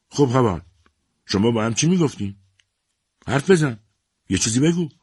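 mother demanding her silent son speak up. A narrator's reading. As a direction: push him hard for an answer.

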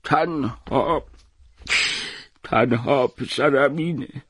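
grieving father naming his only son; choked with grief. Acted. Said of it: "با بغض گفت".

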